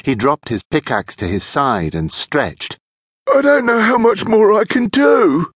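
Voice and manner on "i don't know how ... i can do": high, loud, put-on complaint; high-voiced weary henchman; complaining young thief.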